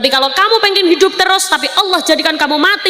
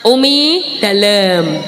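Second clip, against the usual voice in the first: Javanese polite answer; 4 semitones lower, much slower than usual. Shown as a demonstration. Preacher demonstrating how Javanese answer when called, slow and gentle. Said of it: "Kalau di Jawa di-, dipanggil gini".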